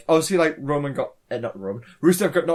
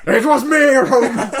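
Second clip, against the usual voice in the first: high, loud laughing reveal; villain reveal; 7 semitones higher, 6 dB louder than usual. Pretend. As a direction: gloat like a wrestling heel.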